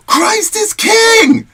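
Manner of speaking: shouted proclamation, high and drawn out; triumphant shout. Retold or imitated. New believer to the whole auditorium at graduation, triumphant shout. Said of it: "turns around in front of the entire auditorium and says".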